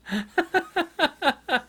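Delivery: a real, high laugh. Real, not acted. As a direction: laugh gently. Laughs again.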